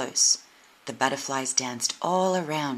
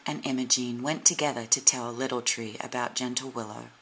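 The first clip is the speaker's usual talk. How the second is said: ordinary delivery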